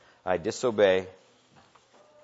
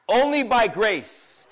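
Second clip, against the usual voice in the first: ONLY raised high, said slowly; stress on ONLY; 8 semitones higher, 5 dB louder, much slower than usual. Acted. Preacher pressing the congregation, slow weight on ONLY.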